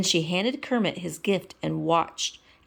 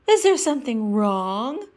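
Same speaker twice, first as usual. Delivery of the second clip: Miss Piggy voice; 5 semitones higher, 5 dB louder than usual. Acted.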